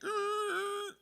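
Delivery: loud, high screech; screeching halt noise. Retold or imitated. Whole world stops at dad's outburst, imitated screech. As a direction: imitate everything skidding to a stop. Imitates everyone stopping dead.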